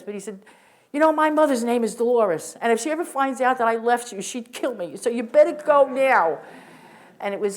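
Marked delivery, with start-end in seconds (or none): 0.9-6.4 loud, higher, urgent calling; retold scream. Greg shouting up the stairwell to her, loud and higher. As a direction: yell it up to her, half joking but urgent. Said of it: "why he screamed this".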